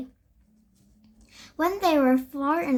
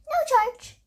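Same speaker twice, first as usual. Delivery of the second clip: generous shopkeeper waving off payment; bakery lady; 12 semitones higher than usual. A narrator's reading. Lady character speaks.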